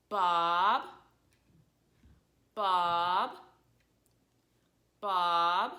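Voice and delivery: "Bob" starting low, swooping up, loud; rising tone. Shown as a demonstration. Teacher showing tone two, "Bob" rising from low. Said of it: "this tone goes down to up".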